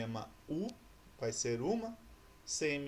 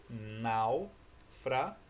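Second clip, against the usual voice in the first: "Náufrago" very slow, náu, fra, go, with pauses; syllable by syllable; 2 semitones lower, much slower than usual. Shown as a demonstration. Teacher answering how to separate it, "Náufrago" syllable by syllable. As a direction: split "Náufrago" into three drawn-out beats. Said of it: "E aí, como que eu separo?".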